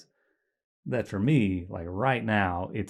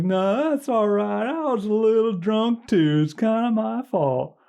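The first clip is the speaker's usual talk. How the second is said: hillbilly narrator; 13 semitones higher, 4 dB louder, slower than usual. Retold or imitated. Narrator answers Ronnie in retelling.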